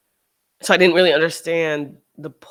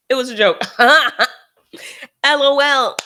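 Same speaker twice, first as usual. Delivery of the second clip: loud mocking voice of a joker; mock the fake joker, loud and bright; mocking quote; 7 semitones higher, 9 dB louder than usual. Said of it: "So they're like".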